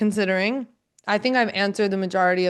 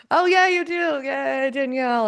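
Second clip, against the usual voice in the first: excited; 5 semitones higher, slower than usual. Real, not acted. Winner of signed copy announced.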